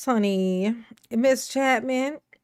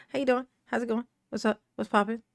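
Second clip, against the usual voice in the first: ordinary talk, nothing marked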